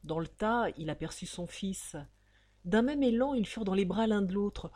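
plain reading voice

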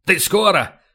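mild impatient bark; barked question. A narrator's reading. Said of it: "Касьян рявкнул".

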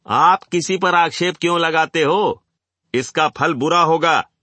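high, loud objection; indignant warning. A narrator's reading. An audience member to the speaker, an indignant loud warning. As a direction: protest loudly and threaten consequences. Gentleman threatens the speaker in quarrel.

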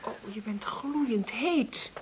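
slow, whispered worry; worried whisper. Acted. Mother feeling her feverish son, in a slow whisper. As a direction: breathe it out in alarm.

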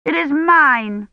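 put-on high, drawn-out possessive child's cry; slow, high-pitched insistence; shouted. A narrator's reading. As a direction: shout it like an indignant little girl. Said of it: "Agnes shouted".

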